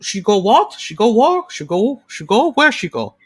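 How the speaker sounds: a hint of a flustered Italian tenor.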